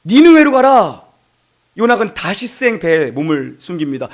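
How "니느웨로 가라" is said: a touch of higher, louder divine command; God's command. Acted. God ordering Jonah to Nineveh, forceful command. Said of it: "하나님이".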